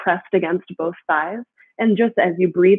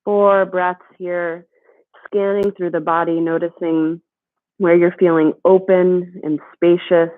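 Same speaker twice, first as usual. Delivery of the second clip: soothing yoga teacher. Acted. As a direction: guide them gently through the breath. Guides a slow body scan.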